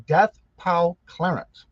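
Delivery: slow, deliberate Death Pow clearance; carefully untangling a tongue twister. Real, not acted. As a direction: spell out "Death Pow clearance" slowly after tripping. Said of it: "Say that three times".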